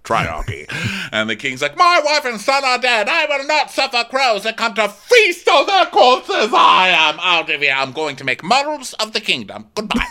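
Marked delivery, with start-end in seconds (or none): 1.8-10.1 high, loud mock-royal rant; outraged outburst. King rants about his dead wife and son, hammed-up fury. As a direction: declaim it loudly in a pitched-up fury, then storm off. Retold king's grieving furious outburst.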